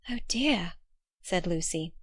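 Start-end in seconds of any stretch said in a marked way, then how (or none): 0.1-0.7 breathless, whispered "Oh dear"; dismayed whisper. Acted. Lucy dismayed by sad news.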